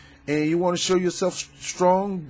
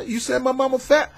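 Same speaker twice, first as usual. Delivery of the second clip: high, hot street challenge; confronting tough guy; 10 semitones higher than usual. Retold or imitated. A guy squaring up to someone over his mama, challenging. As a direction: square up and demand an answer. Acted quarrel continues.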